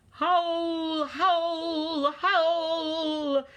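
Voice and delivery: long, high, loud howls; howling wind. A narrator's reading. Narrator voicing the wind for listeners, howling. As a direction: draw each word out like wind howling.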